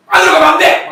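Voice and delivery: loud high yell; sudden yell. Retold or imitated. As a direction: snap it out at full volume. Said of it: "막 뜨기다 딱 자르면서".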